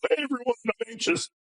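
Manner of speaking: high, sing-out mock greeting to everyone; mock announcement. Dad joking that he doesn't announce his anxiety, mock announcing. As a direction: call it out high, as a joke announcement.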